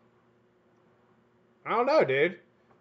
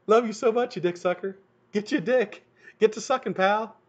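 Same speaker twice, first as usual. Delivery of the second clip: mock daughter voice; 9 semitones higher than usual. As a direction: ham up the daughter's cheeky note. Mocking daughter's note voice.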